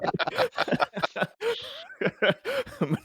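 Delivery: high cackling villain laugh; maniacal evil laugh. Acted. Player laughs about trustworthy guys sticking together, maniacal evil cackle. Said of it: "Maniacal evil laughs".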